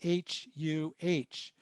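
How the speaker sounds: "H U H" spelled letter by letter, slowly; slow spelling of "H U H" as separate letters. Shown as a demonstration. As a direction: say each letter of "H U H" slowly and apart. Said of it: "drop the word huh".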